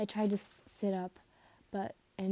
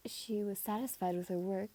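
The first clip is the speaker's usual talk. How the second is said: usual speaking voice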